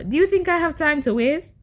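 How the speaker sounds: high, indignant telling-off; cussing someone out. Real, not acted. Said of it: "I don't know why I'm cussing you out".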